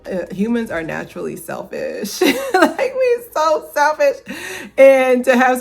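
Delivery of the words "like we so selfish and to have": high, loud laughing through words. Real, not acted. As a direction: laugh while saying it. Laughing about human selfishness.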